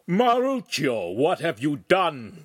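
slow, high, loud outburst; angry shout. A narrator's reading. Master confronts his apprentice over breakage, angry shout. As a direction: shout it, drawing out the name. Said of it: "the master shouted".

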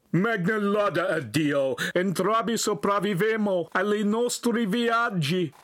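high, hearty Italian shout; shouting back. A narrator's reading. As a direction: holler it back from afar. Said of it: "the big man shouted back".

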